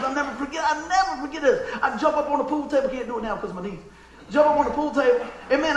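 ordinary talk, nothing marked

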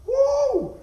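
very high, loud, held "woo"; big celebratory holler; excited whoop. Real, not acted. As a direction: let out a long piercing whoop. Whoop at the woman's return.

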